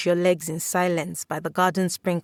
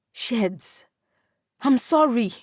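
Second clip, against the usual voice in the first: begging; 5 semitones higher, slower than usual. A narrator's reading. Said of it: "you beg".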